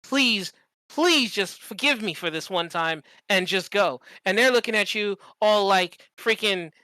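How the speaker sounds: ordinary talk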